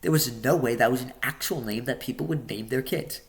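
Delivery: plain reading voice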